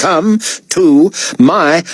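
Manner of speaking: "come to my" slow, each word separated; exasperated word-by-word repeat. A narrator's reading. Artiste repeating the order to David, slow and exasperated. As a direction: spell out the order slowly, word by word.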